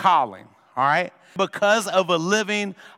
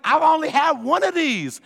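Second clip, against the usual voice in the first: indignant disbelief; 9 semitones higher than usual. Retold or imitated. Teen complains to parents.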